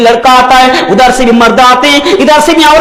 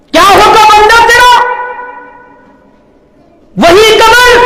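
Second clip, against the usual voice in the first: impassioned warning; 8 semitones higher than usual. Real, not acted. Preacher warns the listener of the grave, impassioned warning. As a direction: call it out high and urgent.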